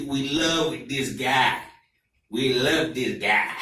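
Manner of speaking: put-on slow, emphatic Ghanaian accent. Ghanaian coworker explains his country's politics, slow serious Ghanaian accent. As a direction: imitate a very serious Ghanaian man, words drawn out. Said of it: "He's from a village in Ghana".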